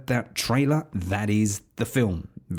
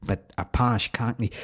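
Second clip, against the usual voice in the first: badly faked American accent of a pushy director; shaky amateur American accent, urging an actor on; attempted American accent. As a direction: put on a clumsy American accent, insisting. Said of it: "meant to be a American accent".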